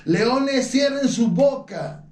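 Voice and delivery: high, slow, stern command to the lions; preacher voicing Daniel commanding the lions. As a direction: order the lions to be still, slowly and from on high. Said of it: "dicho a los leones, ordenado a los leones".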